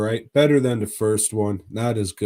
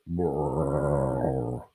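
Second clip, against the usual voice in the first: lower, imitated gut rumble; stomach rumble; 7 semitones lower, 4 dB quieter than usual. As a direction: mimic a sudden gurgling stomach. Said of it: "your stomach will go".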